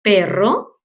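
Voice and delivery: ordinary talk, nothing marked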